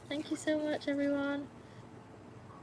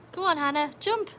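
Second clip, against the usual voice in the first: much higher and louder; egging on the dog; 7 semitones higher, 7 dB louder than usual. Real, not acted. Owner cheering Hannah to jump, high and loud.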